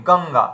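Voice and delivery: গঙ্গা said "gong-ga", ng before the g; ng sound. Shown as a demonstration. Teacher modelling গঙ্গা for students. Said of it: "যেমন আমরা গঙ্গা উচ্চারণ করি".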